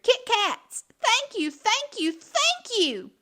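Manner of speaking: loud, high delighted cheer. A narrator's reading. Thrilled little witch cheering for her Kit Kats. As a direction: squeal with thrilled joy. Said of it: "She cheered".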